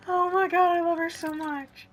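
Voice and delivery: high, loud gushing adoration. Real, not acted. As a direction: gush with pure delight. Gushing over the companion.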